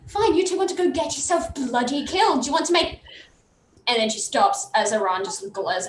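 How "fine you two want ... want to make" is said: higher, mildly heated character voice; exasperated refusal. Acted. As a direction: argue back, fed up with both. Araya angrily refuses to go.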